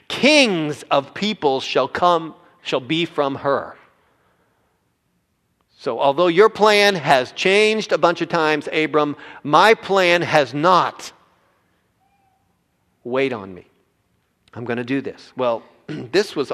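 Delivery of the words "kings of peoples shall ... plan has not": loud, slow, ringing preacher's declaration; full-force preaching. Acted. Pastor to congregation, loud, slow preaching. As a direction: proclaim it over the congregation.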